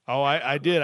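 ordinary talk

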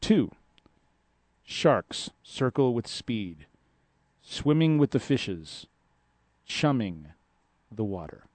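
slow, measured haiku reading; poem reading. Acted. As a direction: read each line like a poem. Reads the haikus aloud.

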